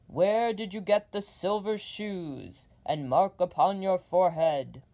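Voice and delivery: loud, booming giant head; demanding Oz. Acted. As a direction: boom out the demand. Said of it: "the voice demanded".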